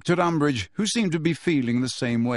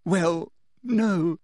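higher, tearful halting denial; fake sob; 4 semitones higher than usual. A narrator's reading. Girl feigns distress to teacher, sobbing. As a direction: answer through pretend tears. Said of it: "sobbed Hermione".

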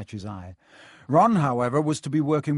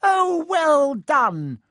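high, slow squeaky delighted cry; excited Flitwick cry; 13 semitones higher, 7 dB louder, much slower than usual. Acted. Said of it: "cried Professor Flitwick".

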